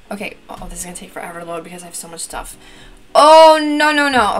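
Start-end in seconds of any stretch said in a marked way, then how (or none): 3.2-4.3 high, drawn-out wail sliding down; loud panicked protest; loud dismay. Real, not acted. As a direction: cry out in alarm, then tumble into repeats. Dismay at slow loading, short time.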